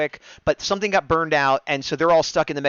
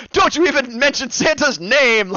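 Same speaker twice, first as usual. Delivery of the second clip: higher, louder, slow outraged yell; mock-furious defence of Santa; indignant; 10 semitones higher, 6 dB louder than usual. Hero scolds the monster for mentioning Santa, slow outraged yell. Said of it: "he gets all indignant".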